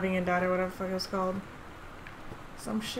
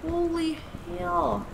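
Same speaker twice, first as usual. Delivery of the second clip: much higher and louder, slow astonished outburst; amazed; 9 semitones higher, 4 dB louder, slower than usual. Real, not acted. Player finds a full library, amazed outburst. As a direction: gasp it out in sheer wonder. Discovers huge library in game.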